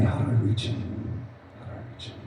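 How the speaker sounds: breathy whisper to himself; whispered inner thought. Retold or imitated. Speaker to himself, wondering how to reach Ray, whispered. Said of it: "So I was thinking".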